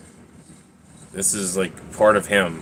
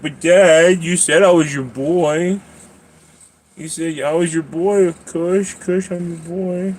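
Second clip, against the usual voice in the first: cat's voice; 6 semitones higher, slower than usual. Pretend. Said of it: "And you're like".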